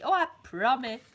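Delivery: loud moaned plea; moaning promise. Acted. Mister Greedy promising the giant, moaning. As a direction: moan the promise. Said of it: "moaned Mister Greedy".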